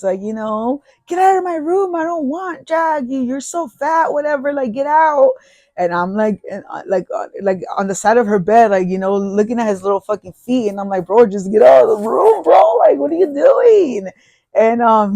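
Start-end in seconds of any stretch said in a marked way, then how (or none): 1.1-5.3 high mock-annoyed yelling; girlfriend shooing brother. Girlfriend telling her brother off, high and annoyed. 11.1-14.1 loud, high exasperated shout; telling him off. Retold or imitated. Hiding boyfriend shouting at the teasing brother to leave.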